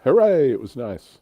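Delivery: usual speaking voice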